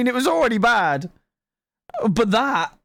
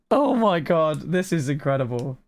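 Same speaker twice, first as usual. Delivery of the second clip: usual speaking voice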